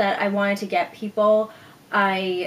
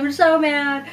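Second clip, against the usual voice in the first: put-on childish mad; 6 semitones higher, 5 dB louder than usual. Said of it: "I felt like a kid again".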